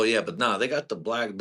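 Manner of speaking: ordinary delivery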